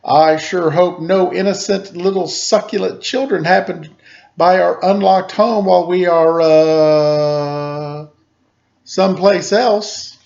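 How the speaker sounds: high, loud scheming mama dinosaur; sly villain mother, slowing at the end. A narrator's reading. Mama Dinosaur hinting at tasty children, hammed-up sly. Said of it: "continued Mama Dinosaur".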